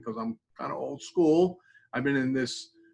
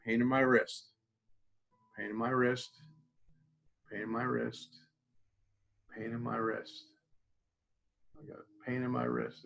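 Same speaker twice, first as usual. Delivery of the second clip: fading repetition; 2 semitones lower, 7 dB quieter than usual. Shown as a demonstration. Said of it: "This time we're just gonna do pressure".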